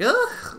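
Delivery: loud, high, squeaky ugh; a cringing ugh, pushed harder this time; sharp high-pitched groan of distaste. Retold or imitated.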